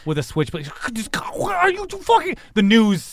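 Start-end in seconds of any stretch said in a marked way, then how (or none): 0.9-2.4 very high, garbled panic; panicked spluttering. Acted. Onlooker faced with switchblade stabbing, high panic. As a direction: splutter it high, words tumbling in shock.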